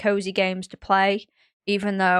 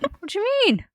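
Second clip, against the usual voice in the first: very high, puzzled question; imitated baffled reply; 13 semitones higher than usual. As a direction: act out an innocent, taken-aback dad. Dad's baffled reply, retold.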